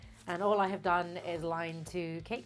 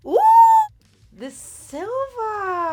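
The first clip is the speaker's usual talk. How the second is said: high, slow delighted gasp; 13 semitones higher, 15 dB louder, much slower than usual. Real, not acted. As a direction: marvel at it. Delight at silver glitter.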